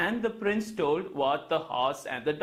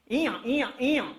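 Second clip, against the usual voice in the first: braying eeyaw in a donkey voice; 4 semitones higher than usual. Acted. Donkey answering the horse with a bray. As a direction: bray back cheekily. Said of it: "the donkey also screamed out".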